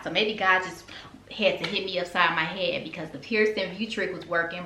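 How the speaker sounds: ordinary talk, nothing marked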